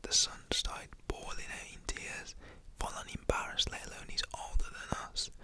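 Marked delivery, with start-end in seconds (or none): none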